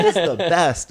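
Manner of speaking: high-pitched retold delight, laughing; excited. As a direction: relive the thrill of a first film job. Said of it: "I was so excited".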